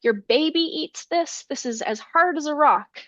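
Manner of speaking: storyteller's mild yell of disbelief; mock shocked yell. Giant breaks his tooth on the loaf, mild shocked yell. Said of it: "he shouts and yells".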